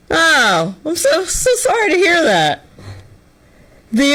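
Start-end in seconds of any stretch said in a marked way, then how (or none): 0.1-2.6 fake sympathy. Sarcastic sympathy.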